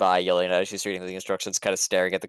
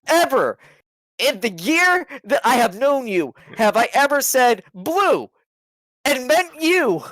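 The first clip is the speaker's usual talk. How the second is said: higher, louder exasperated protest; indignant; 5 dB louder than usual. Acted. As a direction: stress each EVER, fed up. Character indignantly protests nickname.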